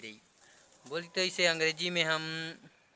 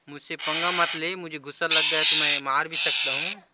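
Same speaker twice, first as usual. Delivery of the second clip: ordinary delivery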